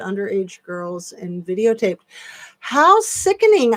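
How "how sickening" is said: louder, higher cry of disgust. Real, not acted. Host reacting to a viewer comment, loud disgusted exclamation. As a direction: recoil at it out loud.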